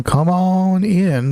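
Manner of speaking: high, drawn-out beckoning; sing-song invitation to latecomers; welcoming call. Real, not acted. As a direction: call them in, stretching it out slowly. Calling more viewers into stream.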